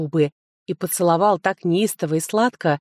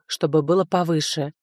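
plain reading voice

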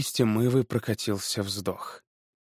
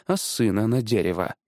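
ordinary delivery, nothing marked

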